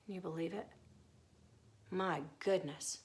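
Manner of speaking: ordinary delivery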